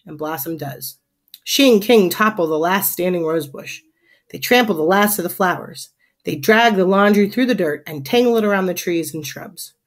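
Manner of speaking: ordinary delivery, nothing marked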